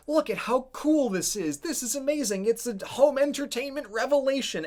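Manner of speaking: high, gushing over a gadget; gushing excitement. Retold or imitated. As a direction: rave about it, voice way up. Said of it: "And the song is like".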